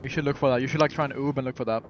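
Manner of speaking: normal talking voice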